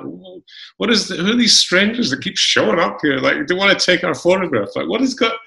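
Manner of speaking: put-on high, bewildered kid voice; a child eyeing strangers warily; puzzled Scottish kids. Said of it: "They're like".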